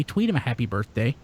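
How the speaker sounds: ordinary delivery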